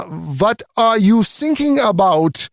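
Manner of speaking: put-on slow, calm German-accented reply; German accent, unhurried and puzzled. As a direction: answer like a relaxed young German officer with a thick German accent. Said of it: "the younger German officer says".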